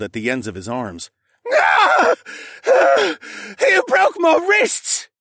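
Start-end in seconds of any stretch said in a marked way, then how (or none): 1.5-5.1 high, loud screech of agony, slow on the last words; pained screech. Acted. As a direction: scream it out with broken wrists. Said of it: "he screeched".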